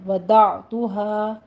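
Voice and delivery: وضعتها slow and stretched; وضعتها spoken at dictation pace; tajweed pronunciation. Shown as a demonstration. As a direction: model the word وضعتها slowly. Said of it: "مد منفصل جائز ہے".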